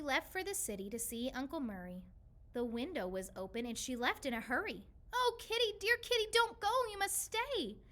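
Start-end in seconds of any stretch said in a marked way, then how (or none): 5.1-7.8 high, loud pleading call after the cat; shouting and crying. Acted. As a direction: call out desperately as she runs off. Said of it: "we shouted and cried".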